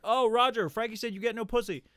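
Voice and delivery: loud, high, taunting tattle; instigating. Retold or imitated. Buddy winding up two teammates, loud instigating taunt. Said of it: "the king instigator".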